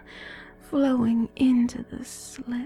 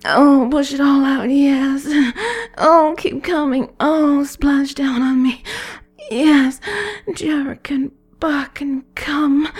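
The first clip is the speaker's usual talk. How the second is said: louder and higher, rising to a peak; building excitement; 4 semitones higher, 6 dB louder than usual. Acted. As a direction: push the energy up to climax. Countdown builds to climax.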